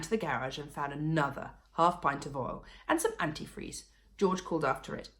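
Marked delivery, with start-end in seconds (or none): none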